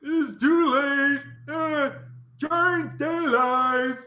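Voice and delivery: high, loud, drawn-out taunt with laughter; laugh, then call it down slowly and loudly; ogre heckler. Acted. Game master as an unseen onlooker above, loud mocking call.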